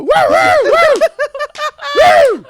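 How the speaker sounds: very high, loud whoops; celebratory whoop. Retold or imitated. Hunters told they may keep the deer, wild loud whooping. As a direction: burst into piercing victory woos. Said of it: "And so they go".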